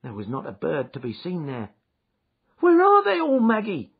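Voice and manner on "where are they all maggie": much higher, louder panicked cry; frantic cry. A narrator's reading. Jem crying to his sister where the geese went, high and loud. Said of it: "I cried".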